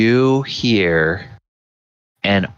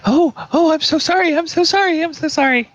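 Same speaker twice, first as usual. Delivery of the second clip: much higher, fluttery repeated apologies; flustered apologetic server; much faster than usual. Acted. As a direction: gush apologies like a panicked waiter. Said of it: "the surfer is like".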